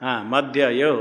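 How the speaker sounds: usual speaking voice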